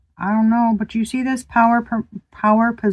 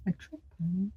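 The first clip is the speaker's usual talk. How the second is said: much softer puzzled repeat; hushed puzzled whisper; 15 dB quieter than usual. Real, not acted. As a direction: say it under your breath in confusion. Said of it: "second take whispered".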